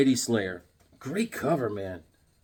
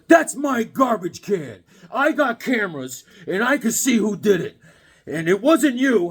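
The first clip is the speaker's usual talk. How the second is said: raised, slowed, indignant retold complaint; imitated angry neighbor; 6 semitones higher than usual. Imitating new neighbor accusing over dog poop, indignant. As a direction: act out an irate garbage-can owner, higher pitched. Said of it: "This guy's angry".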